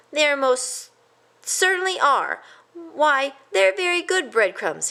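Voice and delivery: high, firm mouse voice; insisting. A narrator's reading. A stubborn cousin insists, high. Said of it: "he insisted".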